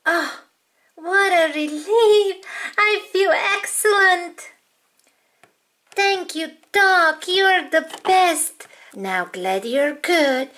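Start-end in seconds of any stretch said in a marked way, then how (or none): 0.1-8.7 higher, delighted doll voice; relieved patient. Acted. Cured doll thanks the doctor, delighted and relieved.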